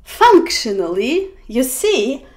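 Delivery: ordinary delivery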